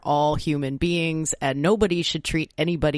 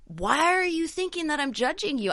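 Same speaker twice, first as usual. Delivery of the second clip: incredulous question; 11 semitones higher than usual. Retold or imitated.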